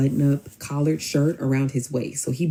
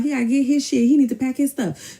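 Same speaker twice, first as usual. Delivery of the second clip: high, insistent mock outrage; indignant retelling; 11 semitones higher than usual. Friend on the phone reacting to him, indignant. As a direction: act out her worked-up insistence, pitched up.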